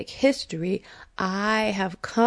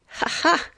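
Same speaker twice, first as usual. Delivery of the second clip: high, breathy laugh through welling tears; real emotional laugh breaking through; tearful laugh; 9 semitones higher than usual. Real, not acted. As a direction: laugh to hold back crying. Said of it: "and I'm getting emotional".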